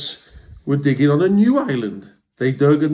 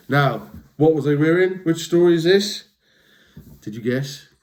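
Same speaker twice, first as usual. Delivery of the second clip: usual speaking voice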